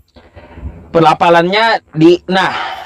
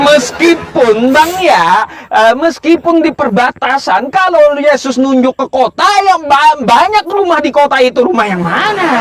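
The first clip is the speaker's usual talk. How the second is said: raised, pressing rebuttal; excited; 9 semitones higher than usual. Real, not acted. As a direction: push back, voice high and animated. Heated rebuttal, pitch raised. (nada tinggi penuh semangat)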